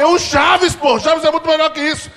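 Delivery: dad raving at the TV; hyped-up father; excited dad rant. Retold or imitated. Dad pushing his favorite show.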